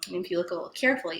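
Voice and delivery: usual speaking voice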